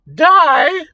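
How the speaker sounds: high shrieked question; terrified furious shriek. A narrator's reading. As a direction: shriek it in terror and rage. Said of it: "she shrieked in terror and fury".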